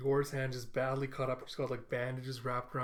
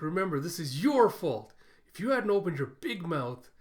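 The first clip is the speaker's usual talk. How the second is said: lightly acted scolding, raised voice; mock blaming; 4 semitones higher, 6 dB louder than usual. [name] blames the one who talked, mildly scolding. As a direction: lay the blame with some force, higher and louder.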